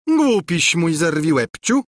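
ordinary delivery

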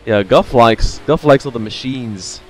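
ordinary talk, nothing marked

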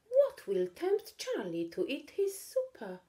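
high curious musing; wondering aloud. Acted. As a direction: puzzle it out brightly. Katy thinking aloud.

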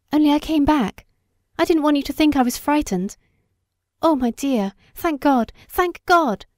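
high, fast, rising joy; relieved outburst. A narrator's reading. Wife overjoyed her husband lives, high and breathless. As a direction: tumble out the words in relief, climbing.

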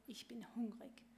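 whispered, faint with hunger; weak whisper. Acted. Starving chick to itself, whispered. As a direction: barely breathe the words out.

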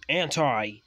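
loud, high angry call; thundering. A narrator's reading. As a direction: thunder the name at the culprit. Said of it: "he thundered loudly".

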